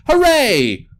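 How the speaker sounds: high, loud cheer; excited cheer. Acted. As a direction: cheer like you've won. Bursting free from straps.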